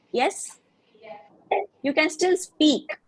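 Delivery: normal talking voice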